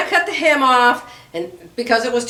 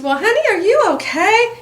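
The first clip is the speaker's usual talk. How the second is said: lightly voiced clueless husband, higher; husband's voice; 9 semitones higher than usual. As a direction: imitate the husband's concerned question, a touch mocking. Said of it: "he said".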